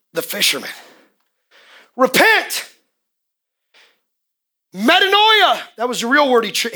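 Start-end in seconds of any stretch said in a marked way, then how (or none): 2.0-2.7 high ringing cry of repent; shouted repent. Retold or imitated. Preacher acts out the fisherman crying repent, raised high. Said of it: "he shouted out". 4.7-5.6 metanoia cried out, high and loud; shouted metanoia. Retold or imitated. Preacher acts out the fisherman crying metanoia to the crowd, loud. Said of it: "he shouted out".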